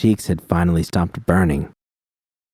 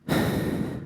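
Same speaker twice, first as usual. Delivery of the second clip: long soft unvoiced sigh; deep breath out; 9 dB quieter than usual. A narrator's reading. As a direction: let a deep breath go slowly. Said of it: "She drew a deep breath".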